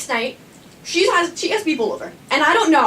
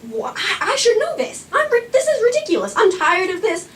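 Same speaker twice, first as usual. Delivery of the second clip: frustrated; 7 semitones higher than usual. Retold or imitated. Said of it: "I was really mad".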